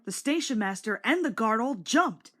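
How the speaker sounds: plain reading voice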